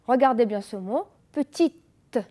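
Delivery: "petite" said with the e silent, pə-tit; mute e demo. Shown as a demonstration. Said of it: "Le E ne se prononce pas". ("petite" sans prononcer le E)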